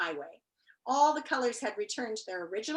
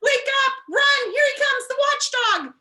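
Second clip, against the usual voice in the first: much higher, loud panicked yelling; panicked shouting; 14 semitones higher, 8 dB louder than usual. A narrator's reading. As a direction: scream the alarm as they flee. Characters flee the watchdog.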